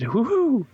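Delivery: single high falling woohoo; one high falling woohoo; mimicked hoot. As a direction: hoot it high like an owl call. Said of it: "she mimicked, he just did what I just did".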